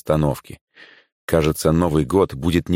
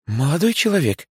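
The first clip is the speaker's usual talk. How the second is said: sweet plaintive voice, pitched high; сладкий жалобный голос; 9 semitones higher than usual. A narrator's reading. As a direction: put on a cute pleading tone. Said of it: "милым голосом с жалобной интонацией". (милым жалобным голоском)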